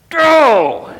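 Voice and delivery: sudden high, loud shout; mock shout. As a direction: bark the word out at the crowd. [name] shooing the crowd away.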